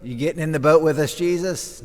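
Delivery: loud, fast, eager call from the boat; disciples calling out. Acted. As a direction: shout it quickly across to Jesus.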